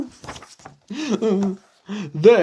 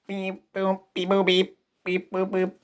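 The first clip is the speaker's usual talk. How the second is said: soft robot beep-boop; 3 semitones lower, 8 dB quieter, faster than usual. Pretend. Child plays the robot costume, soft beeps.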